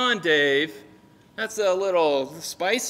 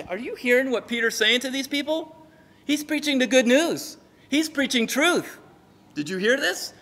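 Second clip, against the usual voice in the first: higher-pitched urgent informant; mildly agitated tattling; 5 semitones higher than usual. Acted. Guy in the back runs to the Sadducee, agitated tattling. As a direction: report it as if lightly scandalized.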